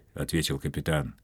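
plain reading voice

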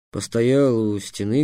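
usual speaking voice

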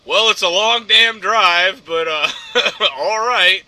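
slow, loud deadpan; a stranger's slow deadpan reply, imitated; mock dry retort. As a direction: drag out the dry comeback.

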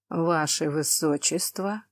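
soft, slow courtly address; slow quiet greeting. Acted. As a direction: say it slowly and softly. Heroine greets the prince.

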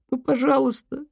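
high, breaking plea; tearful begging. Acted. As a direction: beg through tears. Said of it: "всхлипнула Лу".

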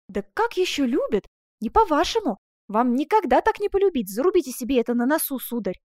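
animated heroine putting him in his place; indignant young woman character. Acted. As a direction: scold him with lively indignation.

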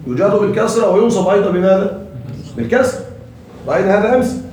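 usual speaking voice

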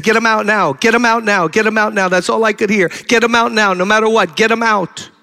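God's voice ordering him to act; urgent divine command. Retold or imitated. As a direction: drive the command home with urgency. Voice of the Lord commanding.

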